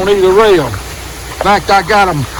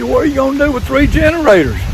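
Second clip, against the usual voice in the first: pitched-up mock wife voice; lightly voiced wife, higher; imitating wife; 9 semitones higher than usual.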